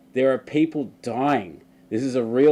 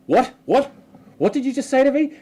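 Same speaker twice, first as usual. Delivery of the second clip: high, loud outraged Karen squeal; indignant Karen; 14 semitones higher, 6 dB louder than usual. Retold or imitated. Retelling the maskless shopper, shrill outraged Karen. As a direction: play the offended customer. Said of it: "And then she's like".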